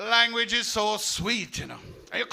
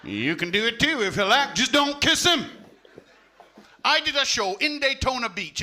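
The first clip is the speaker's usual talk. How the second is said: usual speaking voice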